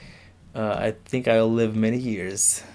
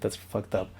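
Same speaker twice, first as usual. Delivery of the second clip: ordinary delivery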